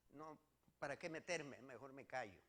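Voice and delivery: ordinary delivery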